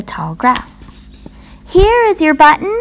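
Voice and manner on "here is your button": high, bright call of discovery; excited cry. A narrator's reading. Frog to Toad, cries out excitedly. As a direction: Frog crying out he found it. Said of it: "cried Frog".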